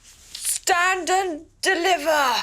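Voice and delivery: high, slow imitation of a highwayman's cry; mimicked shout. Guest imitating the storyteller's highwayman, mock loud shout. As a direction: mimic her shouting, dragging out each word. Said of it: "Very loudly".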